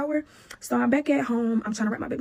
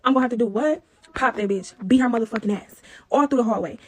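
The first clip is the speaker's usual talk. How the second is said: ordinary talk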